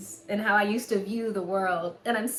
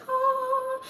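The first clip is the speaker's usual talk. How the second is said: long, held high "aah"; high "ahh". Retold or imitated. Recalling step-grandma's rare nice moments, high held "ahh". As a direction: hold a high, drawn-out ah.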